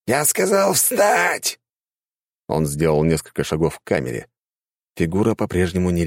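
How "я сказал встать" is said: angry shout. A narrator's reading. Guard repeats order, angrier.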